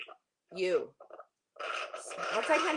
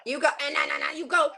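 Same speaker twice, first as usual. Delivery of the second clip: loud, higher, urgent; yelled correction; 4 semitones higher, 6 dB louder, much faster than usual. Real, not acted. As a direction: snap out the correction. Coach yelling, go no go.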